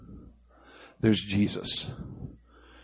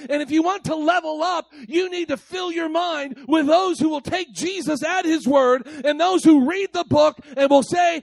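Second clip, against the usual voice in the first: preaching; 13 semitones higher than usual. Real, not acted. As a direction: preach it out to the room at full force. Preacher building to full force.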